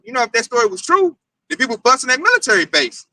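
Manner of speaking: ordinary talk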